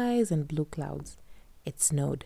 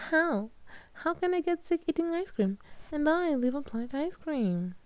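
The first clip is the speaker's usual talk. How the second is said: higher amused Katya; Katya's voice; 11 semitones higher than usual. A narrator's reading. Katya answering Kadzo, higher amused voice. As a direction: laugh off the question, pitched up.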